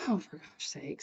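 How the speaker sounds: very soft, low grumble; hushed exasperation to herself; dismayed aside. Retold or imitated. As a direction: say it under your breath, dismayed. Dismayed aside on seeing whole family.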